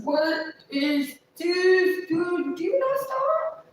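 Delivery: high, stretched-out disbelieving dude; exasperated disbelief. Retold or imitated. Retelling catching Jeff wired up, high exasperated disbelief. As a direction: shriek it like you can't believe what you're seeing. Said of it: "I said".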